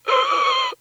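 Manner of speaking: sharp high gasp for air; revival gasp. Retold or imitated. Acting out Shaw reviving, big high gasp. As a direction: mime coming back to life with one big gasp. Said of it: "he was like".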